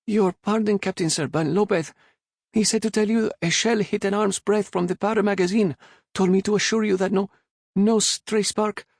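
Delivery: put-on high, loud, nervous boy's voice; a frightened boy rushing his report; young cabin boy nervously reporting to his captain; timid cabin boy.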